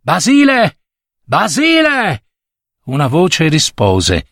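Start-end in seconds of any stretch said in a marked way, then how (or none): 0.0-2.2 high drawn-out call. A narrator's reading. Old servant calls into the dark, calling out. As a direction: call for someone far off down the path. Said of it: "cominciò a chiamare".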